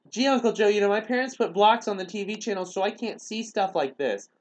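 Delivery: high, hammed-up kid voice; high mock-innocent protest, slowing at the end; naive kid impression. Naive kid voiced in video retelling.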